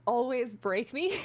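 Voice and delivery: ordinary talk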